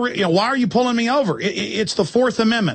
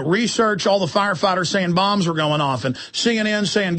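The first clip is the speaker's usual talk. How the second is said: ordinary delivery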